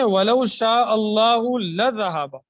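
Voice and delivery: loud emphatic recitation of the verse. Shown as a demonstration. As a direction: call the verse out loud to the class. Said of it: "دیکھیں".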